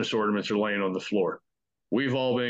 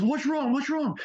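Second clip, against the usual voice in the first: much higher, urgent repeated cry; alarmed shout. Retold or imitated. Friends bursting into Janiyah's room, panicked. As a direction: rush in panicking at the scream.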